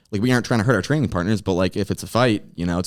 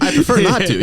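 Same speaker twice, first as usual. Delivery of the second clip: laughing; 11 semitones higher, 6 dB louder than usual. Real, not acted. Laughing answer to teasing.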